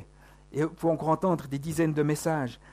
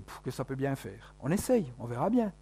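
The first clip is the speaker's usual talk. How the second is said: careless shrug; 5 semitones lower, 8 dB quieter than usual. Retold or imitated. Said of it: "Puis je dis".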